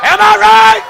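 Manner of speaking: high, loud call to the crowd. Acted. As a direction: call it out to the pews. Preacher calls to the crowd.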